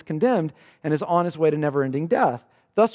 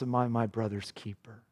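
much softer and lower, a defiant murmured retort; awful retort of Cain; 6 semitones lower, 8 dB quieter than usual. Retold or imitated. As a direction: say it low and hushed, coldly defiant. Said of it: "you get the awful response".

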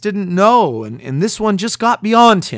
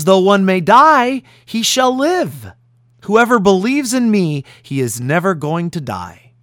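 forceful preaching; 3 semitones higher, 4 dB louder than usual. Acted. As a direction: proclaim it to the congregation. Preaches Jesus' promise forcefully.

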